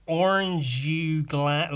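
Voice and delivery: put-on American drawn-out vowels; exaggerated American imitation; American accent. Shown as a demonstration. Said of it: "like, an American accent".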